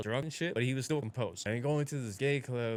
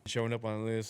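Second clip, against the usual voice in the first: usual speaking voice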